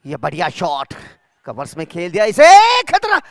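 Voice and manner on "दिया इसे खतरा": rising to a loud, high yell; excited cricket commentary. Real, not acted. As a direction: call the wicket at full pitch. Wicket falls in cricket commentary.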